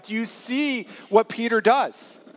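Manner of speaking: ordinary talk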